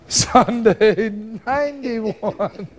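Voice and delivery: slow, wheezing with laughter; drawn-out laughing. Real, not acted. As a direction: stretch it out, laughing. Laughs on number.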